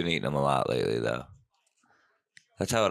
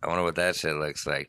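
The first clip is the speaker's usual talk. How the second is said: ordinary talk, nothing marked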